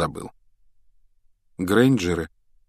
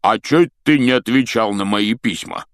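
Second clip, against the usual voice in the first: Hagrid voice; 3 semitones higher, 5 dB louder than usual. Acted.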